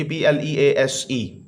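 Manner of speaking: ordinary talk, nothing marked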